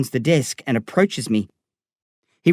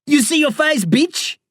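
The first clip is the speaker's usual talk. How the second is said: mocking scream; 13 semitones higher than usual. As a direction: yell it to mock his scared face. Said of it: "he screams".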